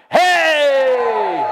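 loud cry of joy; shout. Real, not acted. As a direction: shout it with the crowd. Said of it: "Do it one more time".

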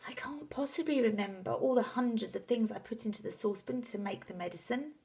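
put-on high, airy boy's voice; worried young son. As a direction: protest helplessly to Dad. Boy character protests.

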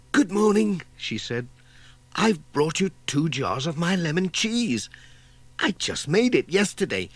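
high, sweet sociable voice; proud village lady. A narrator's reading. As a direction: offer it like a proud home cook. Voices Dorothy Thompson.

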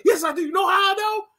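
roast him at full volume; hammed-up shouted rant; mock shouting. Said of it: "He's like".

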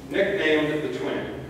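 ordinary delivery

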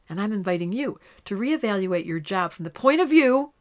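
ordinary talk, nothing marked

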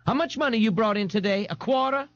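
high-pitched mock scream; shrieky questioning without much volume. A narrator's reading. Mother demanding the day's earnings, mock screaming. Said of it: "Missus Riley screamed".

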